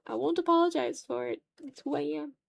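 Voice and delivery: ordinary delivery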